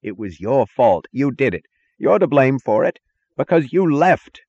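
high, loud accusation building at the end; screaming. A narrator's reading. Taggart blaming Dagny for leaving, screaming. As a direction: scream the blame at her. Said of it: "he screamed".

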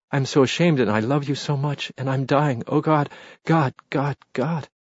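high, mounting ecstatic cries; ecstatic abandon. A narrator's reading. Woman overcome in her lover's arms, ecstatic cries. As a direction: cry out, overcome with love and shame. Love scene at its climax.

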